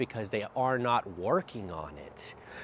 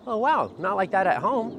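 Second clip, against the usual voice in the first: high, louder mock-surprised owner; imitating surprised owner; 10 semitones higher, 5 dB louder than usual. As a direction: play the surprised owner who doesn't believe it. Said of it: "they're really surprised".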